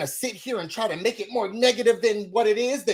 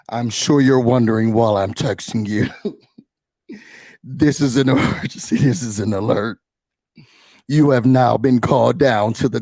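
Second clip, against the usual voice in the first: ominous alert voice; 10 semitones lower, 6 dB louder than usual. Retold or imitated. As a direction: read it like an emergency broadcast of The Purge. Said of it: "gave me real The Purge tease".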